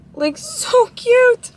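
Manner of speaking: high, slow cooing over the photo; gushing over cute. Real, not acted. Cooing to viewers over her sleeping dog, high and slow.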